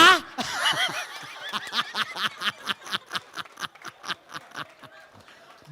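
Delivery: long unvoiced laugh; breathy laugh. Real, not acted. Preacher to congregation, long breathy laugh. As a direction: laugh it off after the joke. Big laugh after punchline.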